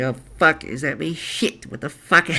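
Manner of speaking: mild mock breakdown; Grumble. Host re-enacting the Skype riff, lightly mock distressed. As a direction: lightly imitate a panicking character. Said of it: "riffing at a Skype call doing that voice".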